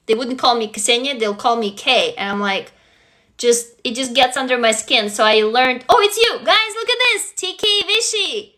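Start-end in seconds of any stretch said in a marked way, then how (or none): 5.9-8.5 high burst of delighted recognition; excited. Real, not acted. Streamer spotting the writer among the guys, excited and high. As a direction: break off in happy surprise.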